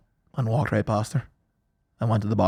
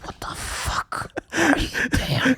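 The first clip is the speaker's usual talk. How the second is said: slow, spaced-out appalled whisper; imitated aghast friend, very slow and whispery; retold drawn-out whisper; 5 dB quieter, much slower than usual. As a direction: stretch each word in hushed disbelief. Repeats the appalled question more slowly.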